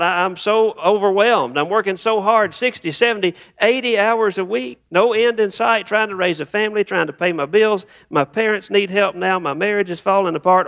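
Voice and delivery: high-pitched, imitated dump of worries; overwhelmed venting. Professional unloading on the pastor at a late appointment, overwhelmed venting. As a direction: pile up the complaints as a frazzled churchgoer. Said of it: "begin to just dump everything out".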